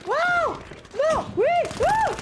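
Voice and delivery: high, loud shrieks of thrill; squealing gamer scream; excited screams. Real, not acted. Screaming during game scare.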